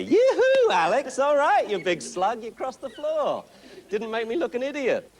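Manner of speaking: very high, gushing "Yoo-hoo" praise; excited call. Shown as a demonstration. Coach showing the owner how to call Alex: high, thrilled praise. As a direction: squeal it out like the puppy's biggest fan. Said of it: "Now get excited about it".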